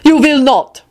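high, loud command; lordly threat, forbidding it outright; threatening. A narrator's reading. Lord Radu forbidding Barnabas to leave, threatening. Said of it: "Radu told him threateningly".

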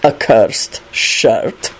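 very slow, low, icy disbelief; cold, deep, deliberate scepticism; cold reply. A narrator's reading. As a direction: draw it out low and coldly. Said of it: "he replied coldly".

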